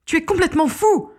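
high-pitched cry over the din; acted shout. A narrator's reading. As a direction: shout in alarm to be heard over noise. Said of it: "cria-t-elle au-dessus du vacarme".

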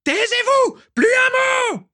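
very high, loud screaming order; furious shout. Acted. Walburga screaming the painter into silence, shrill yell. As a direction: scream at him to shut up.

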